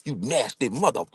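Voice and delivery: high, mock-shocked scolding mother; scolding mama. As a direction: scold your son like an outraged mama. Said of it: "mama slapped me".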